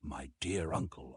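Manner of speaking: soft, low, choked with tears; tearful. A narrator's reading. Nephew thanking his uncle, softly tearful. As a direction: whisper it through tears. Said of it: "big tears rolled down my".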